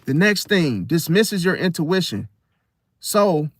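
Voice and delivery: ordinary talk, nothing marked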